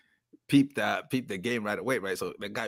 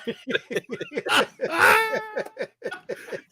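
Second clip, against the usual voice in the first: high burst of real laughter; big laugh; 4 dB louder than usual. Real, not acted. Man laughing at his own story among friends. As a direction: crack up helplessly.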